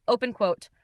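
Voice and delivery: usual speaking voice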